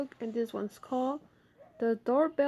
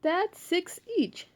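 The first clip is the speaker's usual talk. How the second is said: put-on kids counting; 9 semitones higher than usual. Children voiced in story.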